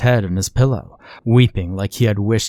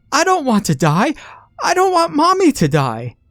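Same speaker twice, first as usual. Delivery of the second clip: wailing cry. Acted. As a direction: wail it out like a terrified girl. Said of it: "a terrible wail and shouted".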